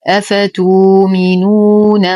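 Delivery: أفتؤمنون recited slowly with the hamza turned to a long و; Warsh recitation. Shown as a demonstration. Teacher recites in Warsh reading for students, slow chant. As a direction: chant أفتؤمنون with no glottal stop. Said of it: "بإبدال كل همز ساكن".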